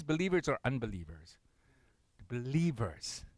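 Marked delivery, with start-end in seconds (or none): none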